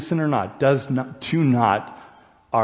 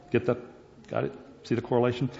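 ordinary talk

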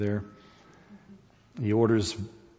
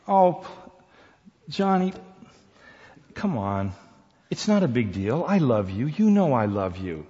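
Susan coaxing; 7 semitones higher, 6 dB louder than usual. Acted.

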